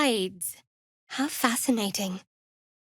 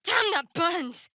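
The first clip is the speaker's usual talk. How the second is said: pained cry; 8 semitones higher, 3 dB quieter, slower than usual. Acted. Burned by something touched.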